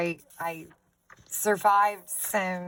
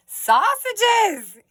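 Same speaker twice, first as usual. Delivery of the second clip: much higher, louder delighted exclaim; excited squeal; 11 dB louder than usual. Real, not acted. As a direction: squeal at the silly picture. Laughing at book picture.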